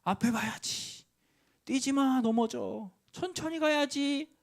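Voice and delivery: put-on high, slowed warning call to a running toddler; a parent's anxious high yell; retold shout at child. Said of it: "제가 소리를 지르는 거예요".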